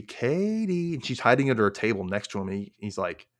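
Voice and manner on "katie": high, drawn-out singsong call; lilting mock summons, pitched way up. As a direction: call the name over, stretched and high. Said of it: "He calls over to Katie".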